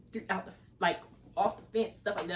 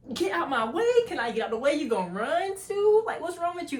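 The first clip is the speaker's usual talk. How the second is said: exasperated; 6 semitones higher, 4 dB louder than usual. Retold or imitated. Retold annoyance at slow walker.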